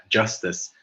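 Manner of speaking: ordinary delivery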